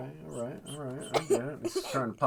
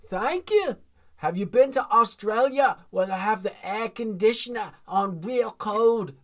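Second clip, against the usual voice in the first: a much higher, louder, bad Australian accent; terrible Australian accent; 8 semitones higher, 6 dB louder than usual. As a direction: ham up a bad Australian accent, slowing at the end. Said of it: "I do a terrible".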